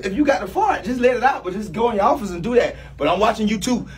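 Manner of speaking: ordinary delivery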